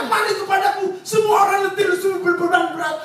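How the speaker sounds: preacher voicing God's invitation loudly; urgent divine call. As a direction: call out to the weary with full force. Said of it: "Tuhan bertalu-talu berkata".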